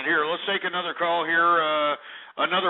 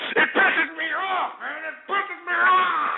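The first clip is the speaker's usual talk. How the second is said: voice breaking; 11 semitones higher than usual. Real, not acted. Rant breaking into cry.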